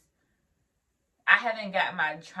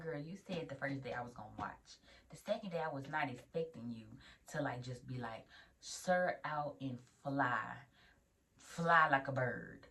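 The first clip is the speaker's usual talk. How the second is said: hushed complaint; 4 semitones lower, 9 dB quieter than usual. Retold or imitated. Quiet complaint to preceptor.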